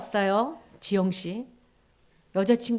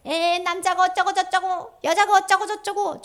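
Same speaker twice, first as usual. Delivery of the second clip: mocking badmouthing; 9 semitones higher, 5 dB louder than usual.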